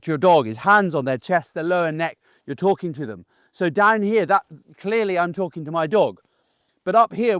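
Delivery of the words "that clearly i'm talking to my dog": usual speaking voice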